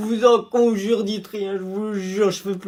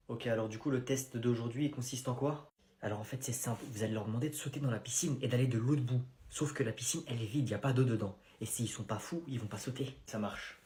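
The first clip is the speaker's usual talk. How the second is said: à voix basse; 12 semitones lower, 14 dB quieter than usual. Acted. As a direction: tout bas, en confidence. (deux adultes complotent sur le test, à voix basse)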